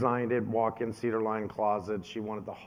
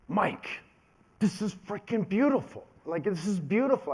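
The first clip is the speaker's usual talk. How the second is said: imitating dad, pitched up high in disbelief; a father stunned by his son's work; lightly voiced awed father; amazed dad; 8 semitones higher than usual. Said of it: "He says".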